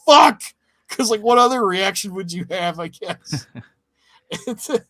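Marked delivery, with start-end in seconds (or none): 0.1-0.4 a loud, high burst of shock; mock shouted curse. Friend retelling the deputy scene, loud mock curse. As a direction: spit it out like a stunned man.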